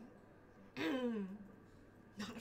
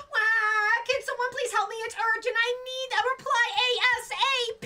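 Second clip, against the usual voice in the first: high, loud whiny plea for help; mock panic; 5 semitones higher, 5 dB louder than usual. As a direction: plead urgently, hammed-up. Acts out panicked text message.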